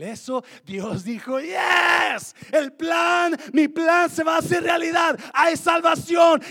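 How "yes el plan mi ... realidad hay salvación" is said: loud, high cry of victory as God; triumphant shout. Acted. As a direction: proclaim it like God's plan winning. Preacher voicing God triumphant.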